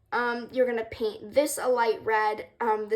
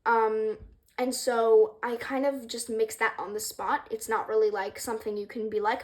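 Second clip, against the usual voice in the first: ordinary delivery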